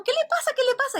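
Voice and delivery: high shocked repeated question; high startled what's wrong; alarmed asking. Retold or imitated. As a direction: sound baffled and alarmed. Guests alarmed at screaming man.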